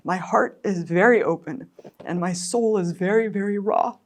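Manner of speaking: high, breaking voice, close to crying; tearful mother, voice breaking; near tears. Real, not acted. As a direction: speak through tears. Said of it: "I'm so emotional about it".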